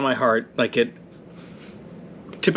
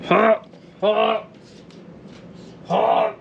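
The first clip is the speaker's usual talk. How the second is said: mouth full of hot food; 6 semitones higher, much slower than usual. Real, not acted.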